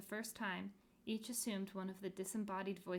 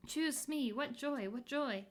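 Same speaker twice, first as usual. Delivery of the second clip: higher, bright bursts of joy; joyful; 5 semitones higher, 5 dB louder than usual. Acted. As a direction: exult like a merry spirit. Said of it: "burst into laughter".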